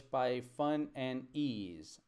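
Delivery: very slow reading of fun and ease. Shown as a demonstration. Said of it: "This is not correct".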